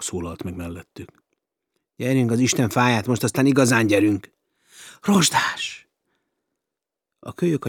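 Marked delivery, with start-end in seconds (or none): 2.0-4.3 louder, pressing them to hurry; urgent louder call to move now. Acted. Rusty returns urging them on. 5.0-5.9 high, loud cry of relief; much higher relieved cry; joyful cry. Acted. Girl cries out seeing Rusty.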